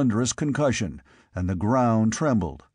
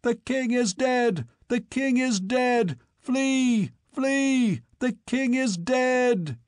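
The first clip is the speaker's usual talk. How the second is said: panicked crowd cry; 12 semitones higher, slower than usual. Retold or imitated.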